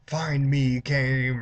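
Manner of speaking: put-on high, drawn-out playful child voice; giggling little girl. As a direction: imitate a tittering ghost child. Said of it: "you hear a little girl giggling".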